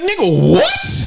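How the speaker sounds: high, loud burst of disbelief; mock shocked outburst. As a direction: blurt it out in exaggerated shock. Reacting in shock to breakfast table act.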